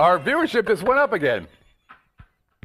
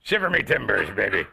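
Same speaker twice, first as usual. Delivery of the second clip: pirate voice; 3 semitones lower, slower than usual. Acted. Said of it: "National Speak Like a Pirate Day".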